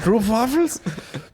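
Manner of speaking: very high, drawn-out "Stroopwafels"; exaggerated attempt. Real, not acted. Host mangling a Dutch biscuit name for laughs, high and drawn out. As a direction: stretch "Stroopwafels" slowly in a comic high voice. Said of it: "I'm probably saying it wrong".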